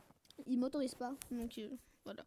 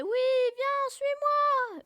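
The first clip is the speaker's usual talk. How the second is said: very high, excited retold call; high-pitched imitation of calling someone over; eager calling out; 10 semitones higher, 4 dB louder, much slower than usual. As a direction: re-enact an overjoyed "follow me". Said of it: "j'ai fait".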